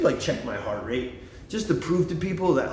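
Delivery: ordinary delivery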